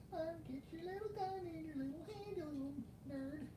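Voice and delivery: put-on very high, soft, squeaky baby voice; tiny coaxing voice. Collector fitting gun into little hand, tiny coaxing squeak. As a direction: coax it in a quick, tiny falsetto.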